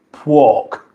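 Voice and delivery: "pork" in mock Cockney; Cockney "pork" with a w after the p; Cockney accent. Shown as a demonstration. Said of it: "Is it a Cockney thing?".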